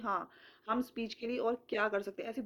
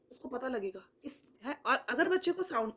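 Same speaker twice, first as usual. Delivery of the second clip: ordinary talk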